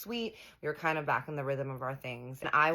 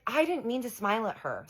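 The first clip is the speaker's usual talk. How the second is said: mocking whiny excuse; 8 semitones higher, 4 dB louder than usual. Imitating husband's excuse.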